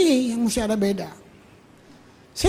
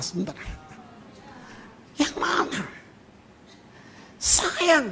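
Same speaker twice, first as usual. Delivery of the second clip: usual speaking voice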